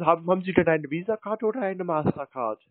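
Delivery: usual speaking voice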